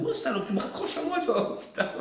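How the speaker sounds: high-pitched mock telling-off that breaks into a laugh; mock outrage. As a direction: pretend to yell at her, laughing. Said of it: "je te dirais". (insulte pour rire, voix aiguë, qui finit en rire)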